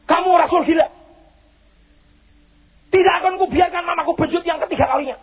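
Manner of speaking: acted angry refusal, pitched high; high, indignant outburst; angry child. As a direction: protest hotly as the outraged son. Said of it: "Terus anaknya marah, saudara".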